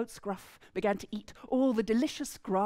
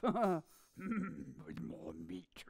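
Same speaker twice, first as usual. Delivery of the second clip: slow, softer gloating whisper; troll whispering greedily to himself; 4 semitones lower, 10 dB quieter, much slower than usual. Acted. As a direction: breathe it out, savouring the meat.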